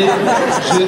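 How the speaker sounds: ordinary delivery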